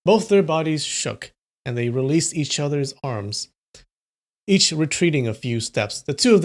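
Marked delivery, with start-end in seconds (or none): none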